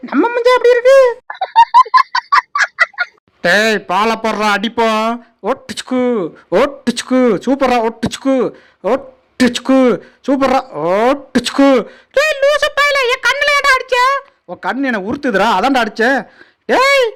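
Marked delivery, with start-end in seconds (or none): 3.4-11.7 deep animal voice. Acted. Voiced animal playing ball. 14.5-16.2 deep animal voice. Acted.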